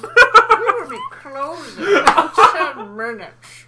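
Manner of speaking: slow, loud laughing store announcement; supermarket announcement. Pretend.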